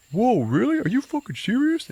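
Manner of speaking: very high, loud mock amazement; astonished disbelief. Boyfriend offered the bottom drawer, imitated astonished delight. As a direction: shoot the pitch right up, imitating his disbelief.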